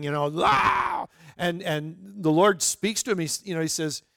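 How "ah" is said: sudden high-pitched scream of fright; a short terrified shriek, as the disciples saw Jesus on the water; retold scream. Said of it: "they start screaming".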